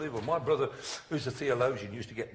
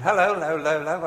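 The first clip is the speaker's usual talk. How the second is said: loud hello fading like an echo; echoing building; 3 semitones higher, 10 dB louder, faster than usual. Retold or imitated. Vicar greeting him in Anglican church, mimicked echo. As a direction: mimic a hello bouncing round an old building. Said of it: "The great thing about these old buildings".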